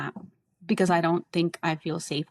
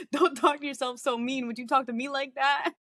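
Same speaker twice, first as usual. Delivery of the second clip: lightly voiced higher mock Carly; a hint of a preachy friend, pitched up; imitating Carly; 6 semitones higher than usual. To her friend, teasing in a mock Carly voice. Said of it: "Carly's like".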